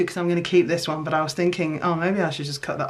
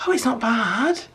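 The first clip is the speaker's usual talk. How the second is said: ordinary talk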